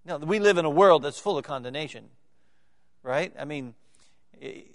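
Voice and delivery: ordinary delivery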